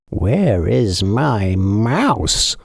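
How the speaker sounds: very slow, drawn-out cat voice; grumbling cat. A narrator's reading. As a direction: drag it out like a sulky cat. Cat imagined speaking.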